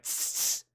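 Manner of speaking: tss hiss. Retold or imitated. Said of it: "I'm like".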